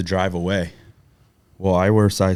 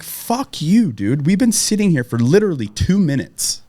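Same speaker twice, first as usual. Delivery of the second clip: retold angry yell; 7 semitones higher than usual. Telling off the driver who honked, retold furious yell. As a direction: fire it back at the guy in a rage. Yelling back at impatient driver.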